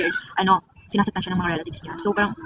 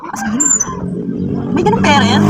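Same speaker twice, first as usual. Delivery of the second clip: sharp rise, louder, stunned by what she heard; shocked disbelief; 6 semitones higher, 4 dB louder, slower than usual. Retold or imitated. Vlogger retelling her reply to a friend, shocked disbelief. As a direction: react like you can't believe a parent would do that.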